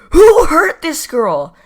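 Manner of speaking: loud, high, drawn-out disbelief; exasperated outcry. Retold or imitated. Teller reacting to the girl's threat, exasperated outcry. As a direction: cry out in baffled outrage.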